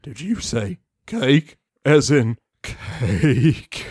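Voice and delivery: low, slowing right down on cake; intrigued pony. Acted. Feuding pony hearing about cake, low slow interest. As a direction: voice a deep pony drawn in by cake.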